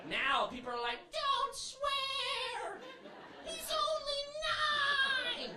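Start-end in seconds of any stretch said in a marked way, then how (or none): 1.1-5.6 much higher, sing-song scolding parent; mock a fussy parent in a squeaky voice; shrill protective parent. Mocking overprotective parents.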